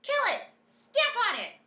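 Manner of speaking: loud high yell, grabbing at the mouse; shouting. A narrator's reading. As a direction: cooks yelling to catch it. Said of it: "they were shouting".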